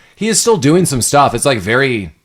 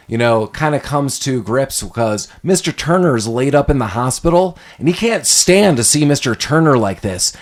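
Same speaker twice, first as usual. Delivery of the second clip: usual speaking voice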